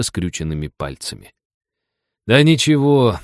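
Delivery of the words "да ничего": higher, louder brush-off; dismissive. A narrator's reading. Forester brushes off Джек's zombie act, dismissively. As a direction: wave him away with your voice. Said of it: "отмахнулся от него лесник".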